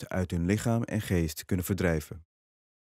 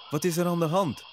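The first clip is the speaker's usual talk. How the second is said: concerned question, pitched up; worried, higher-voiced old guru; 8 semitones higher than usual. A narrator's reading. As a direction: ask with raised, anxious concern. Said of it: "vraagt de oude guru bezorgd".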